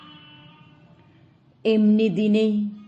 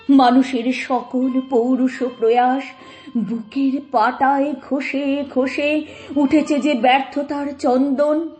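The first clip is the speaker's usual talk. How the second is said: higher, swelling poetic lament; impassioned declamation; 4 semitones higher, 4 dB louder than usual. Acted. Mourner addressing the friend's face adorned by death, impassioned higher declamation. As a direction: cry out the verse to the departed.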